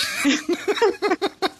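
long high laugh. Real, not acted. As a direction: burst out giggling. Laughing about editing.

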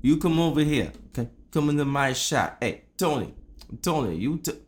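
put-on hammy Italian-American mobster accent; mock mafia Italian accent. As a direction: play an Italian-American shop boss. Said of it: "I get to do my Italian accent".

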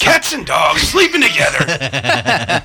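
high, loud, drawn-out movie-quote cry; mock hysteria. Co-host to group as the world turns upside down, mock hysteria. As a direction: cry it out slowly in mock panic. Quoting film line, mock alarm.

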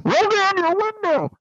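high, loud cop yelling through a TV; TV cop shout. Retold or imitated. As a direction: bark it like a cop on the telly. TV cop heard through door.

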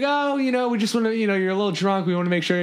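mock soothing. Counselors fake-soothing the drunk kid, high and sing-song. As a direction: placate him in a high, falsely sweet voice. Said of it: "And we're like".

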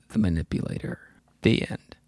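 low, soft story ending; hushed closing line. Retold or imitated. Storyteller giving the manipulator's last words, hushed and low. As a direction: drop down low and soft to close the tale.

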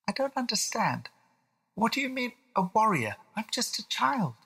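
put-on high, small child voice; a confused boy questioning; puzzled little William. William asks dad what warrior means, puzzled child voice.

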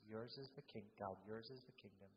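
soft, low, fading repetition; hushed meditative repeating; quiet murmured prayer. Real, not acted.

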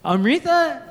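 ordinary talk, nothing marked

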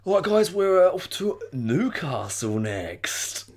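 high, loud start settling into a slow announcement; radio host link. Acted. Radio host handing over to Newcastle, mock presenter voice. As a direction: put on a DJ sending listeners to the next station. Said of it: "That's what they'd say".

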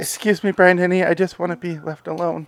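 high, thin put-on voice; put-on meek character. Co-host acting out the character to his pursuer, high meek voice. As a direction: plead in a silly high-pitched impression. Acts out the actor's character pleading.

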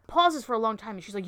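normal talking voice

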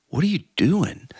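high, startled call into the dark; a baffled cry of surprise; jumping at a voice in the dark; startled question. Retold or imitated. Startled at stranger on trail.

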